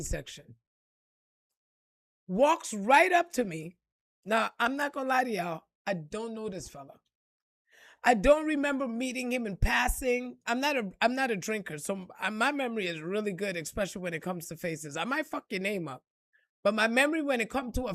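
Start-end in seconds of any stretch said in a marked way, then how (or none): none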